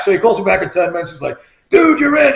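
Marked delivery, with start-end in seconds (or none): 1.7-2.3 high-pitched, eager announcement; retold excited friend. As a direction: imitate a buddy breaking good news. Friend calls back with good news.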